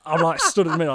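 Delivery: ordinary talk, nothing marked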